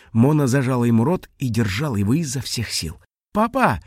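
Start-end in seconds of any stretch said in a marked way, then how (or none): 3.3-3.8 high, innocent-sounding question; breezy high-pitched query; casual tone. A narrator's reading. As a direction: ask lightly, feigning nonchalance. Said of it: "непринуждённым тоном".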